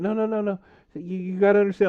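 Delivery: high, frantic pleading ex. Retold or imitated. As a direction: beg him to listen, voice up high.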